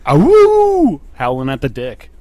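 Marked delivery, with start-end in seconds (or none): 0.1-1.0 high loud wolf howl. Pretend. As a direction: throw your head back and howl. Howls after Dick Wolf.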